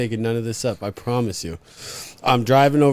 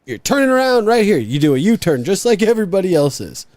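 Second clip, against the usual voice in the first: high, louder bossy guard voice; mock the guard laying down the law; stern guard; 7 semitones higher, 4 dB louder than usual. Guard ordering the driver to turn around, high and bossy. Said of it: "he's like".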